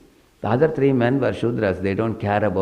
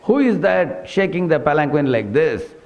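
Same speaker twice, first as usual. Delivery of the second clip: angry king; 6 semitones higher than usual. Retold or imitated. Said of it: "He yelled at".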